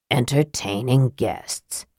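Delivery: low, soft, slow "Entertaining guests,"; soft puzzled repeat. A narrator's reading. Raistlin repeats Sturm's strange remark, low and slow. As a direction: echo "Entertaining guests," slowly under the breath. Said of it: "Raistlin repeated".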